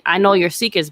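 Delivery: ordinary talk, nothing marked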